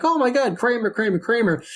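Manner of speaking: high-pitched imitated gushing; excited party chatter. Party guests chattering about Kramer, mock excited and high. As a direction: chatter like a thrilled party crowd. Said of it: "there's this little chatter like".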